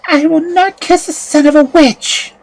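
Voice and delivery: high, loud firm refusal; defiant girl. A narrator's reading. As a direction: refuse the witch's son outright. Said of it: "replied Prunella".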